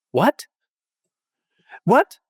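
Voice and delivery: stunned, almost angry double take; a stranger thrown by a kind offer; retold startled disbelief. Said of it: "almost like she was angry".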